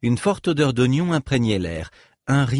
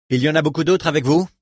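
voix rude; 5 semitones higher, 5 dB louder, faster than usual. A narrator's reading. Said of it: "d'une voix rude".

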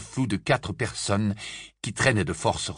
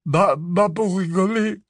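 flustered correction; 9 semitones higher than usual. A narrator's reading. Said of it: "rectifia Ron".

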